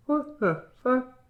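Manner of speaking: slow, high, soft disbelief; hushed mock astonishment; retold bewilderment. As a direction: act out a small baffled reaction, drawn out. Retells baffled reaction to slamming door.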